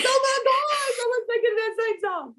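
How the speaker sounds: mock-excited high voice; excited husband. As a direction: squeal it like he just caught you singing his song. Husband's excited quote, high far.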